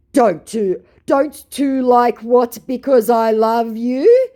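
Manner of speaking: high, loud, slow puzzled reading of garbled words; scammer voice. Acted. Reading out the scammer's garbled message, slow mock-dramatic voice. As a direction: stretch out the muddled message theatrically. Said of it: "that was really weirdly hard to read".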